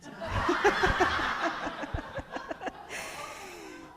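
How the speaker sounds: breathy laughter at the punchline; laughing. Real, not acted. Preacher laughing at her own punchline. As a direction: laugh at your own joke. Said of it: "laughs at own punchline".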